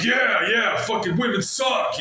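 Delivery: sarcastic bro impression; mock bro voice. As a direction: parody a dumb bro cheering along. Mock agreeing with donut shop guys.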